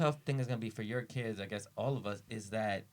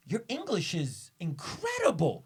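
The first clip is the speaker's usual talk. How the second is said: imitated amazed compliment; 10 semitones higher, 5 dB louder than usual. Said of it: "He goes".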